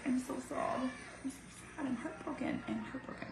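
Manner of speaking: high, small, soft sad voice; sad self-talk. Retold or imitated. Mother retelling thoughts in her head, softly sad. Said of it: "say to myself in my head".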